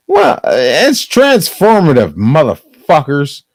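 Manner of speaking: loud, high defiant shout. Real, not acted. Defiant loud declaration to copyright holder.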